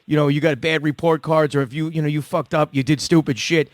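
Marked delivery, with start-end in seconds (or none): none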